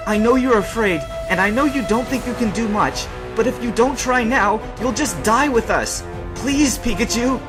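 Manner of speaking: urgent pleading. Acted. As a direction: plead desperately, pitched well up. Ash begs frightened Pikachu to fight.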